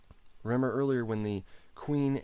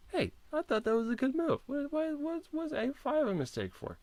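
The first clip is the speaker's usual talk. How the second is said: much higher and louder, mock-outraged protest; indignant surprise; 3 dB louder than usual. Real, not acted. Streamer protesting to the engine about his move, high indignant surprise. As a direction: squeal in disbelief at the engine.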